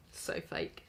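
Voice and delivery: breathy whisper, under the breath; sneering whisper; whispered aside. Real, not acted. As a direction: whisper it like a muttered jab. Sarcastic aside.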